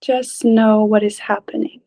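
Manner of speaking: ordinary talk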